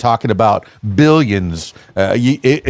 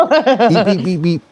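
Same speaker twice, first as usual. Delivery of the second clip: squeaky eeps; 8 semitones higher, slower than usual. Pretend. Squeaky mouse noises again.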